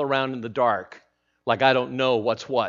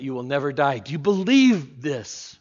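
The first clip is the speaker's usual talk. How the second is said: normal talking voice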